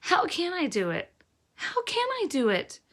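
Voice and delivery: strained repeated plea; anguished cry. A narrator's reading. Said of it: "his entire body screamed".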